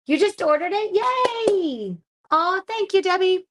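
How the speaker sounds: high, loud delighted squeal; thrilled cheer for a viewer's order; excited thanks. Real, not acted. Card maker cheering Debbie's order, high and delighted.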